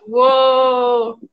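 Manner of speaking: a loud, drawn-out whoa of wonder; amazed whoa. Real, not acted. Host marvelling at the guest's collectible room, loud amazed whoa. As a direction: gasp at the cool room, genuinely impressed.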